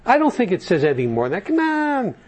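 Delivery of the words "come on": high, drawn-out coaxing "Come on"; tempting devil. Retold or imitated. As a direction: coax it like a sly devil. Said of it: "the devil says".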